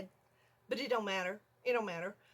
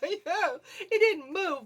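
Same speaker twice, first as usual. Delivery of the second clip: laughing; 8 semitones higher, 8 dB louder, much slower than usual. Real, not acted. Laughing while recalling mouse.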